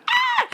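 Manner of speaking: short, very high-pitched mock scream; imitated scream. Retelling a friend's scream in the car, mock shriek. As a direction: let out a quick high shriek, imitating someone startled. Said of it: "We heard T scream".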